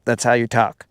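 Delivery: normal talking voice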